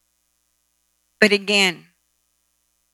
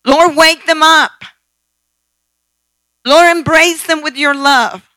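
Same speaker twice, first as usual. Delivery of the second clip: fervent prayer; 4 semitones higher, 8 dB louder than usual. Shown as a demonstration. Preacher shows the church how to pray fervently, louder. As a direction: cry out to God for them. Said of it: "Do you see how I'm praying?".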